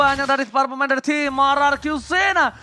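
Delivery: high, loud hype call; esports caster. Acted. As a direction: shout the big play out. Caster reacts to big teamfight.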